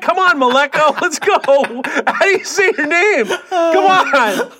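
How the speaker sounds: high-pitched, laughing exasperated rant; playfully heated, voice pushed up high; mock exasperation. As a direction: badger them in a worked-up high voice. Mock scolding over the name.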